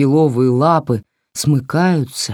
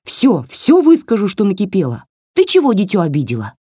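higher, snappy little doll telling her off; scolding doll voice; 6 semitones higher than usual. Acted. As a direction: scold like a cheeky tiny doll. (высокий бранчливый голосок куклы)

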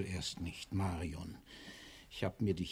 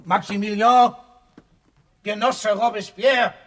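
full yell, high and loud; frantic high shout; 9 semitones higher, 12 dB louder than usual. Acted. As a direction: bellow the name to wake the dead. Yelling to rouse the body.

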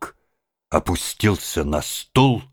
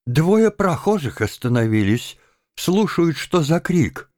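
alarmed women; 11 semitones higher than usual. A narrator's reading. Women fear the neighbours hear.

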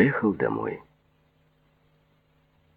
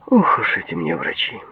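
ordinary delivery

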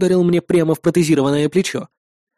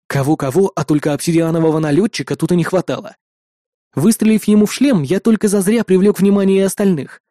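usual speaking voice

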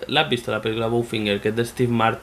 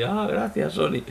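higher, hammed-up teary gratitude; mock tearful thanks; 4 semitones higher than usual. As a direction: play it as a fake-weepy thank-you. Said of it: "con lágrimas en los ojos ahí". (gratitud llorosa fingida, más aguda)

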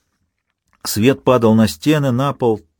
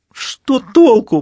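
near tears; 5 dB louder, much slower than usual. Acted. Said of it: "прошептал тот, чуть не плача".

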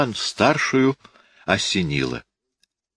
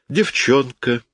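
hidden mockery; 6 semitones higher, slower than usual. A narrator's reading. Officer dismissing cousin's affair to aunt, veiled mockery. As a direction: let the scorn sit under the word. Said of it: "с затаённой насмешкой".

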